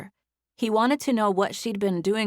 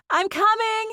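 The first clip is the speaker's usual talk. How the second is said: high, loud call to someone off the phone; yelled; 5 dB louder than usual. A narrator's reading. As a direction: yell it across the room. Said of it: "Zoe yelled to someone".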